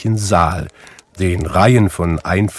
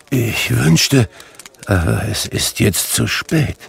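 usual speaking voice

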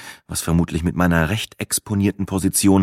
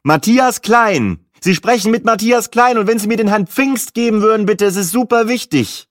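very high, raised caller's voice; shouting into the phone; 4 dB louder than usual. A narrator's reading. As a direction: call it up urgently to a distant phone. Calls up to held-aloft phone. (laut und dringend nach oben gerufen)

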